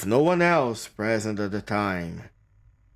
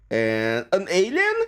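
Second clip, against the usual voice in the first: slow, rising double-take; drawn-out puzzled surprise, pitched up; incredulous question; 4 semitones higher, slower than usual. Real, not acted. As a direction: ask it slowly, climbing high in disbelief. Spots alien in a photo.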